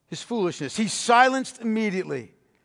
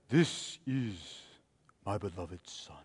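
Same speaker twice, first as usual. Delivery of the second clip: very soft and much lower, reverent hush; hushed old-time preacher; 14 dB quieter, slower than usual. Shown as a demonstration. Preacher mocking how old preachers voiced God, hushed. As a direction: murmur it like a preacher of yore. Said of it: "hushed tones of, you know, the preachers of yore".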